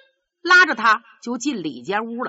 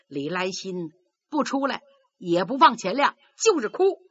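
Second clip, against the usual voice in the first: usual speaking voice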